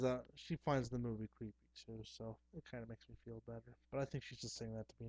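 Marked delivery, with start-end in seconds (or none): none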